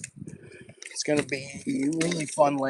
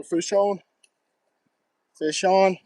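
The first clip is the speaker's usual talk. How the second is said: higher, loud call; excited call; 6 semitones higher, 6 dB louder, slower than usual. Real, not acted. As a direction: call it out as the line pulls. Calling out hooked fish.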